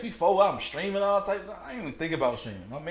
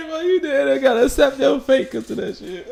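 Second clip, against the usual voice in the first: words squeezed out high through laughter; laughing while talking; 9 semitones higher, 7 dB louder than usual. Real, not acted. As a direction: talk through the giggles. Still laughing at the clip.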